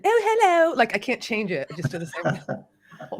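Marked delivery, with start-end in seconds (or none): 0.0-0.7 high-pitched, hammed-up Australian hello; badly faked Australian accent. Shown as a demonstration. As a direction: attempt Australian, landing in the same high fake voice. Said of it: "Oh, I'm doing Australian".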